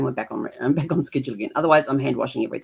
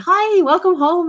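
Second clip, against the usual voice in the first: high, slow, sugary welcome; sweet innocent greeting; 13 semitones higher, 7 dB louder, slower than usual. Retold or imitated. Greeting husband sweetly to hide the new paint, high and slow. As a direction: play innocent with a high sing-song.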